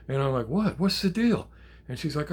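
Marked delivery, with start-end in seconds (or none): 0.5-1.5 high, loud, startled questions; alarmed questioning. Retold or imitated. Grandpa answers granddaughter's cry for help, alarmed questioning. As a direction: re-enact a sudden alarm on the phone.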